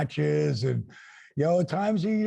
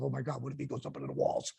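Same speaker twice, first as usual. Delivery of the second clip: inner thought; 4 semitones lower, 10 dB quieter, much faster than usual. Retold or imitated. And I'm thinking to myself.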